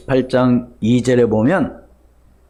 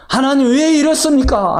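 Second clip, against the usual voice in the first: higher-pitched acted plea to God; imploring prayer; 11 semitones higher than usual. Believer asking God why this happened, acted pleading. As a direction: cry out the question, mock-praying.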